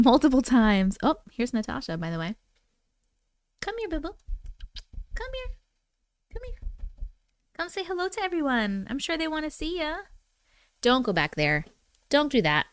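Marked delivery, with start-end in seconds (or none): 3.6-10.0 coaxing the cat, high and soft; high soft pet voice. Real, not acted. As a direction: call the cat in a high hushed coo. Calling the cat over.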